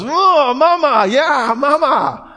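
very high, hammed-up squeal of joy; delighted mama. Mother hears her baby's first word, mock delighted squeal. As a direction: squeal like a thrilled new mother, words drawn out. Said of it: "the mama goes".

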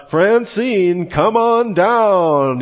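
high, slow, drawn-out host announcement; game show announcer call. Retold or imitated. Game show host calling a contestant down, drawn-out announcer call. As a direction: boom her name like a TV game show host. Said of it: "the young woman gets her name called".

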